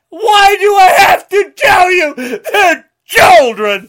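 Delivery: sobbing and shouting with outrage; crying shout. Real, not acted. Ranting at Republicans, crying and shouting. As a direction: yell through real tears. Sobbing rant all through.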